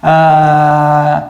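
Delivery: usual speaking voice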